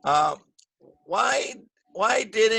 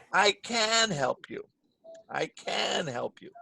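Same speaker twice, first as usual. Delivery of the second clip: "can" drawn out and leaned on, slow; emphatic "can"; stress on can; 4 semitones higher, slower than usual. Shown as a demonstration. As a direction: insist with a long "can". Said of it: "I make it longer and louder".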